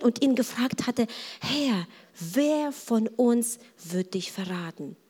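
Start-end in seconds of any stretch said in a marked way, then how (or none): 1.4-5.0 slow, softer, half-whispered asking; intimate, slowed murmur; hushed question. Acted. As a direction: ask it confidentially, close to the master. Disciple leaning on Jesus asks privately.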